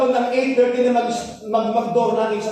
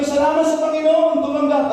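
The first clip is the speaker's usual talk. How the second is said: joyful thanks; 7 semitones higher, 5 dB louder than usual. Retold or imitated. Retold joy.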